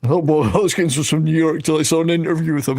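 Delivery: ordinary talk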